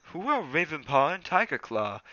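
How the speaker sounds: plain reading voice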